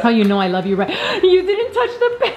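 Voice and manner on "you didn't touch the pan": high gasping disbelief; shocked gasp. Real, not acted. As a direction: gasp it out in alarm. Gasps at touching hot pan.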